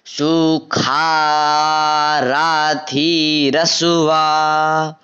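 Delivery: सुखाराथी रसुआ with ष said as ख, slowly; recitation pronunciation. Shown as a demonstration. Teacher demonstrating the sounds, slowly chanted. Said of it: "मैंने आपको क्या बताया था".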